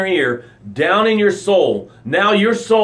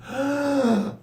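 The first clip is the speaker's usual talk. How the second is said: acted gasp; 9 semitones higher, 6 dB quieter than usual. Taking breaths after near drowning.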